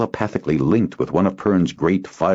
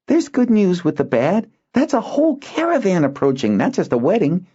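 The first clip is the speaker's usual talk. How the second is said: put-on boy Zinar. Boy pointing out the good news, upbeat. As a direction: cheer him up.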